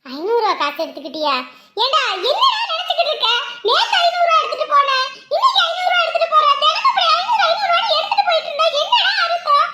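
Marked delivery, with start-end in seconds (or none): 1.8-9.7 high, loud, heated tirade; furious scolding. Acted. Mother catches son taking money daily, furious scolding. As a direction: lay into the son, pitch soaring.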